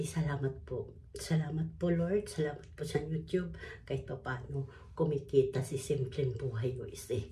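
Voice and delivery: ordinary talk, nothing marked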